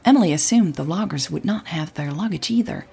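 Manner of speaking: plain reading voice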